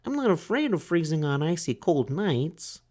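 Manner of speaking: high, timid little turtle voice; small turtle Franklin. A narrator's reading. Franklin asks the duck for help, high and timid. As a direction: ask like a shy child.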